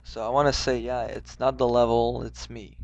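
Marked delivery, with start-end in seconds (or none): none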